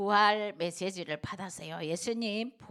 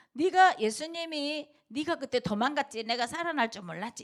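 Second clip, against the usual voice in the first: high, loud accusing tone; mock reproach; 6 semitones higher, 4 dB louder than usual. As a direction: needle him for running away. (예수님이 책망하는 척 높고 크게)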